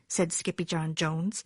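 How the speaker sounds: ordinary delivery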